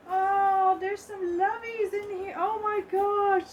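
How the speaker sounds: high, loud, drawn-out coo of delight; delighted surprise. Real, not acted. Unboxer finding loveys in her box, thrilled. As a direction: gush in a high voice, stretching the words.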